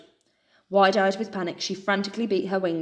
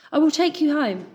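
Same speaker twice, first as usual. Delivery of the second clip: stag's reassurance; 9 semitones higher, 5 dB louder than usual. A narrator's reading. Stag reassures animals.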